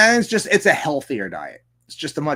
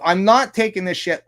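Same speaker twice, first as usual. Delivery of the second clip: normal talking voice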